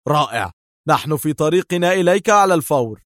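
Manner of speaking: excited exclamation; excited exclaim. A narrator's reading. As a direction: thrill at the news. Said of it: "تألقت عيناه وهتف".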